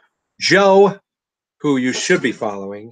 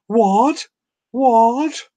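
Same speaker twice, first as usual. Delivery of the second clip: surprised; 11 semitones higher, 4 dB louder than usual. Real, not acted. Reacting to the new guy.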